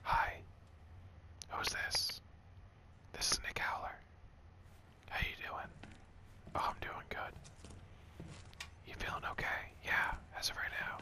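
soft, breath-only whisper; mock ASMR whisper. Streamer fakes an ASMR phone call, whispering. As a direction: play at ASMR, whispering into the mic. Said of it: "to be doing A S M R".

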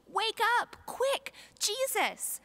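high, loud, slow urgent plea; panicked plea. Acted. The friends begging sleeping Jesus to wake, panicked plea. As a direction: beg him to wake up, terrified. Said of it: "they screamed".

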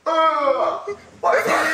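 shocked. Real, not acted. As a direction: blurt it out in shock. Wasabi burn reaction.

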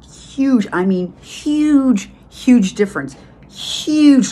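each huge punched high and loud; emphatic huge. Real, not acted. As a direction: hammer home how huge it was.

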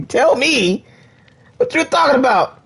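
high, loud demand. Real, not acted. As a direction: press him loudly to explain. Shouting at Lucas, laughing.

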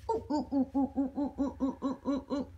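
soft, breathy monkey hoots; monkey ooh-ooh. Acted. George eyeing the big box, quiet monkey hoots. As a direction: hoot softly like a little monkey.

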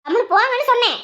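high, insistent brush-off; exasperated. Acted. Irritated wife repeats her dismissal. (கடுப்பில் குரல் உயர்த்தி அதட்டலாக)

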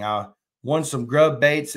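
normal talking voice

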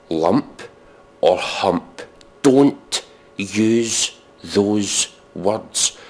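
lump and hump said with slow disgust; disgusted stress. Real, not acted. Comedian warning listeners, slow disgusted stress. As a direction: draw out hump and lump in distaste. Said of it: "least sexy words".

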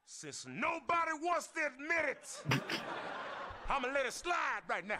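high, loud, slow boast with laughs; hammed-up tough-guy yell; mock tough shout. To the bully Rodney, a hammed-up loud boast. Acting tough to class bullies.

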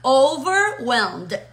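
slow "Overwhelmed" in two clear halves; drawn-out teaching model of "Overwhelmed"; divided in two. Shown as a demonstration. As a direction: stretch out "Over", then "whelmed". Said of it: "Está dividido en dos palabras".

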